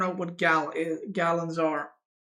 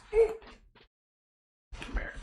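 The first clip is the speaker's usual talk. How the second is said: high pet voice; 6 dB quieter than usual. Real, not acted. Calling the pet duck.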